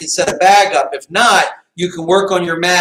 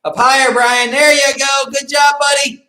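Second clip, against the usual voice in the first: coach shouting encouragement; 8 semitones higher than usual. Real, not acted. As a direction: cheer him on across the room.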